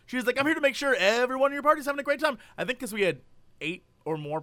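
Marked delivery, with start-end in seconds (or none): 0.4-2.4 high, quick customer-service patter; mock peppy coordinator. Coordinator assures the party of a great time, mock peppy. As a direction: imitate an eager host rattling off the welcome.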